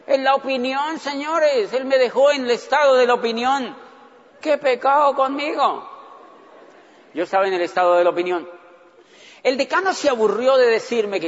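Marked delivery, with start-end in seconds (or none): 4.4-5.9 slow, higher mock wail; hammed-up self-pity, drawn out; theatrical pity for himself; mock lament.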